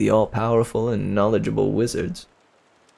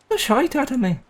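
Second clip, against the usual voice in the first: much higher, mock Cockney "shite"; high hammed-up Cockney accent; 14 semitones higher than usual. Shown as a demonstration. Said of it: "scared the shit out of me".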